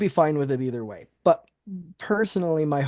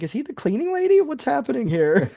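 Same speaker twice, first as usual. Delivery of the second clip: high-pitched mock disbelief; laughing, exaggerated bafflement; bewildered; 10 semitones higher than usual. Joking to co-hosts about the wrestler's yellow gloves, bewildered.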